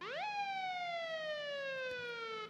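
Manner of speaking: long, high, soft squeal of laughter; drawn-out squeaky giggle. Real, not acted. Laughing at the game.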